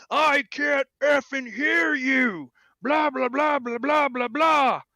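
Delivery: put-on high, loud, slow ranting old man; hammed-up furious rant at the speaker box; imitating an angry old man. Old man at the drive-up yelling at the girl, mock furious rant. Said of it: "he flips out at this poor girl".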